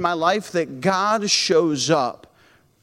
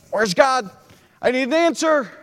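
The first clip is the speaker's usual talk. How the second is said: higher, pleading voice of a desperate believer; desperate plea; 7 semitones higher than usual. Acted. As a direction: cry out like someone left waiting.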